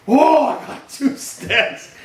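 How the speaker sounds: put-on slow, high, loud whoa of a thrilled child; amazed little boy. Grandfather imitates grandson thrilled at finding two sticks, slow and high. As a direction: stretch it out like a kid who just found treasure. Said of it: "He's like".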